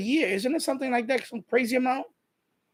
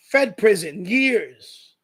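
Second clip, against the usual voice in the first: usual speaking voice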